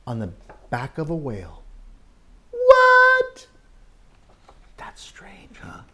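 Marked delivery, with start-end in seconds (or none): 2.5-3.5 very high, loud "What?"; mock surprise. Storyteller reacting to the whale picture, high and amazed. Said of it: "mock surprise at whale". 4.8-5.8 hushed whisper. Acted. Whispering to the children about the strange picture. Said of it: "whisper to kids".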